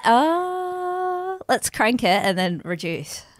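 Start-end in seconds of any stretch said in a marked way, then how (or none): none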